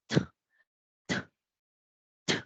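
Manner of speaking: a sharp puff of air on "t", no vowel; isolated English "t", released with audible air; aspirated English T. Shown as a demonstration. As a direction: blow the "t" out as a voiceless burst. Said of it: "La T en inglés no es como la T de nosotros".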